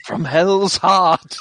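slow, grand villain quote; dramatic quote. Pretend. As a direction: intone it like a doomed captain.

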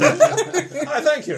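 grand mock thanks.

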